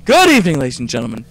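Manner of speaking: loud, high announcer welcome; hammed-up show host. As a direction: greet the audience like a TV host. Opens the episode as host.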